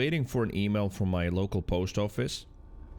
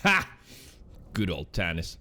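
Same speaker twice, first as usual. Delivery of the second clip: ordinary talk, nothing marked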